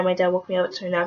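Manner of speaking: ordinary talk, nothing marked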